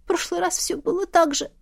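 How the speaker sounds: high, whimpering voice; on the edge of sobbing, pitched high; upset girl's plaintive memory; tearful. A narrator's reading. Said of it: "Она всхлипнула".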